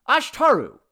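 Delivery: high, loud call of a name. A narrator's reading. Man trying to get the god's attention, calling out. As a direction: cut through the singing. Henry calls over the singing.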